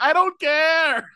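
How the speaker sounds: high, drawn-out mock outburst; retold defiance. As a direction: stretch each word in exaggerated dismissal. Retelling own defiant outburst.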